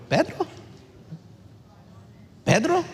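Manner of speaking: Pedro? rising high and soft, in disbelief; incredulous question. Real, not acted. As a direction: ask Pedro? as if you can't believe it. (¿Pedro? preguntado incrédulo, agudo y suave)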